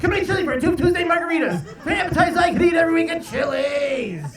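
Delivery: loud, high-pitched ad announcer; radio commercial voice. Acted. Comic showing what interrupts his nature sounds, shouted radio commercial. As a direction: blare it like an ad breaking in. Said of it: "it sounds like this".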